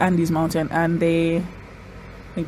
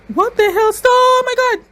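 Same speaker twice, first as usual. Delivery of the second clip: high-pitched horrified exclamation; real stunned squeal; shocked outburst; 4 dB louder than usual. Real, not acted. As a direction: cry out in shock, pitch shooting up. Shocked by gruesome scene on screen.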